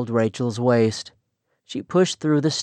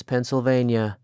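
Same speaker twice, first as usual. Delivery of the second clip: usual speaking voice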